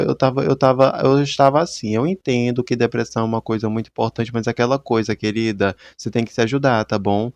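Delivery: usual speaking voice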